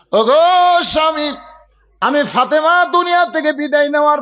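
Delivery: high-pitched pulpit plea; impassioned preaching. Acted. Preacher as Fatema pleading with her husband, impassioned preaching. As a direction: call it out as a dying wife's appeal, at full force.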